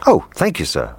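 cook thanking the gentleman; grateful cook. Acted. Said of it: "replied the cook".

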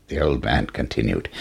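ordinary delivery, nothing marked

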